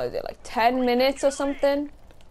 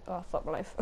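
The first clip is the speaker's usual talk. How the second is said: ordinary delivery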